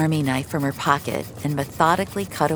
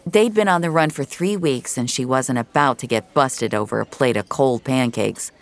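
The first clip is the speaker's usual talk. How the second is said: plain reading voice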